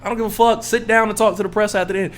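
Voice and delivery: normal talking voice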